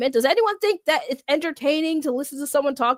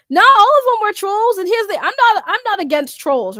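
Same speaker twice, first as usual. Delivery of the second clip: emphatic; 9 semitones higher, 6 dB louder than usual. Real, not acted. Defending missed chatters.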